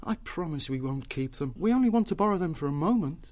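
put-on sincere, urgent young plea; earnest pleading. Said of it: "spoke earnestly to the newt".

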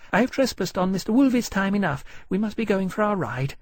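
high-pitched, polite lady making excuses; nervous society lady. Acted. Lady excuses herself from the dragon, nervously polite. Miss Montague makes excuses.

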